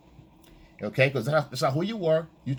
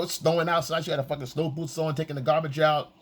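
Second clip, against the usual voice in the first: usual speaking voice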